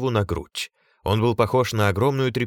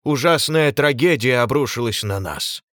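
theatrical lament; 5 semitones higher, 5 dB louder than usual. A narrator's reading. Lord's dramatic address.

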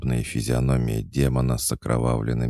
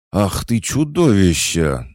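higher, louder furious outburst at the monster; furious cry; 9 semitones higher, 7 dB louder than usual. A narrator's reading. Big sister rages at the demon biting her sister, furious cry. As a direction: hurl it at the creature in rage. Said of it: "злобно прорычала Демидова".

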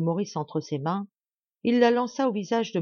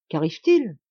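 louder anxious question; worried, pressing question; alarmed; 7 dB louder than usual. A narrator's reading. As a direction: demand to know what happened. Duke and wife alarmed.

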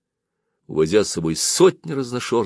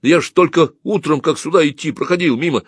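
high, agitated protest; raised, startled boyish voice; incredulous; 8 semitones higher, 4 dB louder than usual. A narrator's reading. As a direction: question the news in disbelief. Shocked youth disbelieves club is gone.

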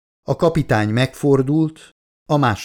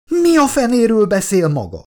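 very high-pitched outraged screech; 12 semitones higher, 4 dB louder than usual. A narrator's reading. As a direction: shriek it at the captain in disbelief. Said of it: "visította a kapitány arcába Vickers". (felháborodott, magas visítás)

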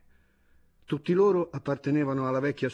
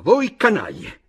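loud, high, slow angry shout; shouted insult; 6 semitones higher, 10 dB louder, slower than usual. A narrator's reading. Chojnicki finally finding his insult, shouting it. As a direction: hurl the word once found. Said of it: "cercava il termine appropriato".

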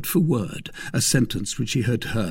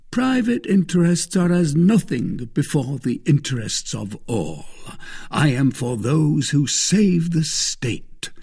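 raised, weighty declaration; emphatic; 6 semitones higher, 3 dB louder than usual. A narrator's reading. Husband declaring himself for the state, emphatic. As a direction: drive each point home pompously. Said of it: "said Bonacieux emphatically".

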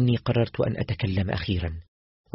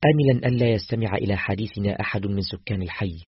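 plain reading voice

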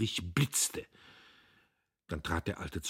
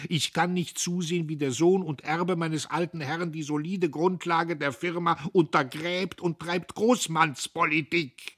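high, loud lament; loud wailing old man; wailing plea; 10 semitones higher, 6 dB louder than usual. A narrator's reading. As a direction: wail it in despair. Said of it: "jammerte Södbier".

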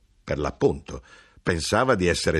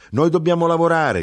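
high, loud and stubborn retort; proud grandfather; 10 semitones higher, 5 dB louder than usual. A narrator's reading. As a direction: throw it back like a proud labourer. (il nonno fiero, alto e forte)

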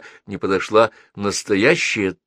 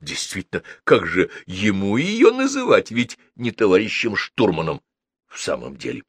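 ordinary delivery, nothing marked

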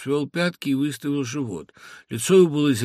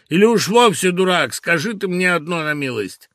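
put-on the father lecturing the boy, weighty and stern; imposing, grave tone; 5 semitones higher, 8 dB louder than usual. A narrator's reading. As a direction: hammer home each word like a stern sermon. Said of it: "внушительным и важным тоном".

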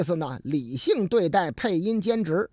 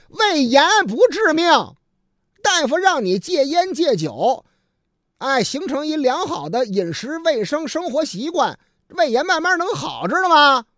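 loud, raised-pitch telling-off; exasperated policeman lecturing; 8 semitones higher, 6 dB louder than usual. Acted. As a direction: drum it into a foolish patient. 警察训斥、开导病人. (又急又无奈地高声训导)

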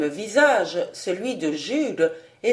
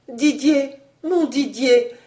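raised, higher-pitched call, understated exhaustion; exhausted cry; 5 semitones higher, 4 dB louder than usual. A narrator's reading. Exhausted girl crying out to Didier from below. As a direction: cry out for a lost beloved. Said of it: "cria la voix épuisée".

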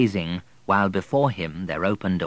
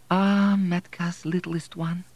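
tender mother; 10 semitones higher, slower than usual. Acted. Mother embracing her son.

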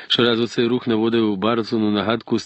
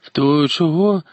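insistent; 7 semitones higher, 3 dB louder than usual. A narrator's reading. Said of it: "настійливо перепитав Юнг".

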